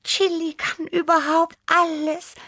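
very high, admiring little cat; Tibi. A narrator's reading. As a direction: marvel in a tiny high cat voice. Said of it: "sagte Tibi bewundernd". (bewundernd, ganz hohe Stimme)

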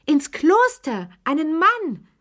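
very high, slow, loud outcry; shocked shriek. A narrator's reading. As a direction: cry out in horror, pitch up high. (entsetzter, hoher Aufschrei)

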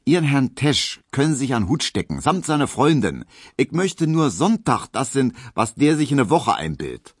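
high, loud, quick Berlin scorn; scornful Berlin woman. Acted. Hedwig dismisses her suitor's colleague, scornful. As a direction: dismiss him high and punchy, in dialect.

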